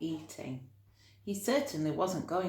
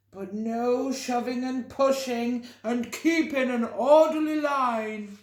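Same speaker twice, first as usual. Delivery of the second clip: pompous elephant; 6 semitones higher, 6 dB louder than usual. A narrator's reading. Elephant addressing the gathered animals, pompous and loud. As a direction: boom it out like a self-important elephant. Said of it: "said the elephant".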